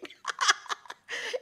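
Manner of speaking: high scoffing laugh; mocking laugh. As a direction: laugh in disbelief at the plea. Dog scoffs at man's plea.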